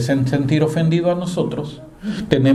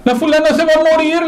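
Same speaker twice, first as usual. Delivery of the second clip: high, louder whiny child's voice, imitating a weepy kid; crying little boy; 12 semitones higher, 5 dB louder than usual. Little Pepito repeats to his mother, high and tearful. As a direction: wail it up high like a small boy insisting. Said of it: "llega llorando".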